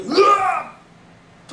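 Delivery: long, high, loud scream of fright; a drawn-out yell that cracks into a laugh; terrified yell. Retold or imitated. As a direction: cry out in horror at the ghost. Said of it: "自分の声でびっくりして".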